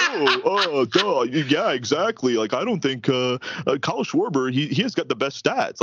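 dopey, dim-witted mock voice; dumbed down voice. Shown as a demonstration. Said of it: "dumbed down voice on my thing".

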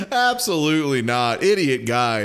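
ordinary delivery

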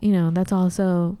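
ordinary delivery

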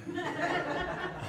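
a real high burst of laughter. Real, not acted. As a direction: laugh out at the grandson's question. Laughs at grandson's question.

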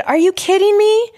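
high, loud cry of disbelief; shouted in shocked disbelief, voice leaping high; retold scream. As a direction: re-enact a shocked scream, pitch shooting up. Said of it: "I screamed bloody murder".

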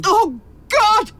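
high, loud cry of fright; startled cry. Acted. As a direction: gasp it out in shock. Terrified by the intruder's voice.

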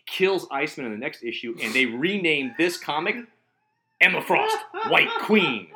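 building to a high, loud squeal on Emma Frost; excited fan. Real, not acted. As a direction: gush with rising excitement. Fan gushing over favourite character.

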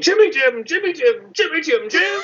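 high-pitched manic chanting of a name; El Duce. Retold or imitated. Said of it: "El Duce from Boondock Saints going".

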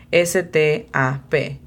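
slow, letter by letter; spelled out slowly. Shown as a demonstration. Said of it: "O para to stop es T U".

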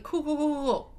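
imitating him rushing to reassure her; eager reassurance. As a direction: rattle off the cools, keen to help. Voicing partner's eager reassurance.

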